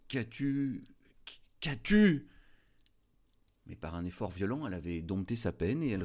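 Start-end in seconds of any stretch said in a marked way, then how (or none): 0.1-0.8 a first, fairly high worried question; husband asks his wife, startled by her tears; stammering worry. A narrator's reading. A touch of worry in the question. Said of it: "Il bégaya". 1.6-2.3 higher and louder, the second ask turns to alarm; alarmed repeat. A narrator's reading. Husband repeats the question louder to his crying wife. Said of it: "Il bégaya".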